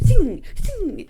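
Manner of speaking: high sharp ting sound effect; ping like steel on steel; imitating Finnick's trident strikes with a sharp high ting; metallic ting.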